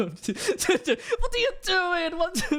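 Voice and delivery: high, drawn-out fake German; bad German accent. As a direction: exaggerate a hopeless German accent, slowly. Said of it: "I can't do a German accent".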